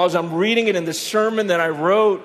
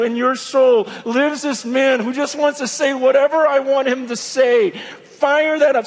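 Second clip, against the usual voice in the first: higher, louder rousing sermon; fervent preaching; 6 semitones higher, 4 dB louder than usual. Acted. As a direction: preach it with fire. Preaching God's message at full force.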